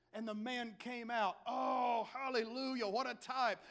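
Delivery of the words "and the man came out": usual speaking voice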